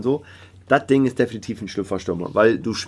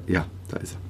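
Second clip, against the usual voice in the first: whispering; 5 semitones lower, 8 dB quieter, faster than usual. Real, not acted. Muttering relief at laptop.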